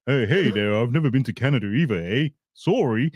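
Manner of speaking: hammed-up Canadian caricature; Canadian parody with a friendly lilt; mock Canadian accent. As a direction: put on a cheery Canadian accent. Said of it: "That's Canadian".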